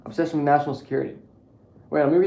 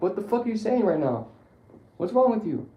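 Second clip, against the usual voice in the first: incredulous; 8 semitones higher, 4 dB louder than usual. Retold or imitated. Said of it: "I'm like".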